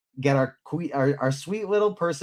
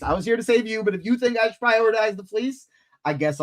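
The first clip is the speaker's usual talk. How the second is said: higher-pitched mock protest; acted reluctant concession; 10 semitones higher than usual. Host voicing Percy giving in to Annabeth, mock-protesting. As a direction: pitch it up, half-protesting as you give in.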